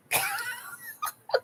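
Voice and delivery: a helpless, high-pitched squeal of laughter. Real, not acted. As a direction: burst into a breathless squealing giggle.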